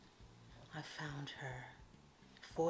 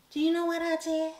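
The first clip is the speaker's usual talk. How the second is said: much higher, louder teasing question; teasing hype; 11 semitones higher, 11 dB louder than usual. Real, not acted. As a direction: bait the viewers to guess.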